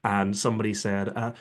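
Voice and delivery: ordinary talk, nothing marked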